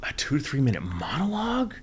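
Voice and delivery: shocked, high, breathy disbelief; incredulous whisper. Real, not acted. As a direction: gasp it out in disbelief. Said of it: "Oh my God".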